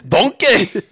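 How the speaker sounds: mock Shrek impression. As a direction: ham up a Scottish-tinged Shrek. Quoting Shrek back and forth.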